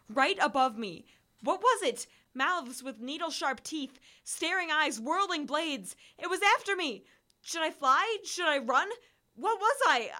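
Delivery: breathless terror, voice climbing high; panicked, high and loud. A narrator's reading. Narrator in the woods facing the monster, panicked and loud. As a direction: gasp it out as if the monster is right above you.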